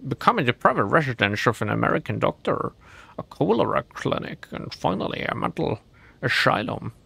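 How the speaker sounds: a hammed-up Scottish accent reading the lore, Sean Connery style; mock Scottish accent on a spooky history. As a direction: read the house history like Sean Connery narrating. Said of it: "It's Sean Connery".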